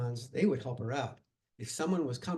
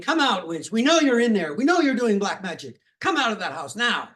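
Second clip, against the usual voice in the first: loud, higher, furious shouts at the house; angry crowd yelling; 7 semitones higher, 11 dB louder than usual. Acted. Mob yelling at the hidden witch's house, angry shouting. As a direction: bellow it like a mob at the door. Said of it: "they were yelling at the house".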